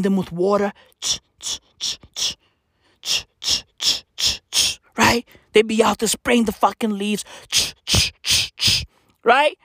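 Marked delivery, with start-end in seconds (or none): 1.0-5.3 spray bottle noises. Retold or imitated. 7.5-8.9 spray bottle noises. Retold or imitated.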